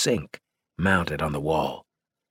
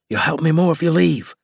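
high, louder urgent reply; urgent insistence; 10 semitones higher, 5 dB louder than usual. A narrator's reading. Rescuer telling freed woman to leave, urgently. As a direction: insist with raised pitch and force.

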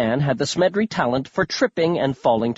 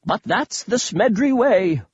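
put-on higher, theatrical old man voice; eccentric old grandpa; 8 semitones higher, slower than usual. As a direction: play a dotty, thrilled old grandpa.

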